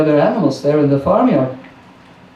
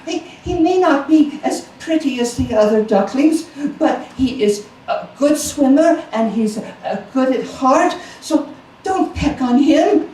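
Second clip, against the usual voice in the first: pleading mother duck; 8 semitones higher than usual. A narrator's reading. Mother defends duckling.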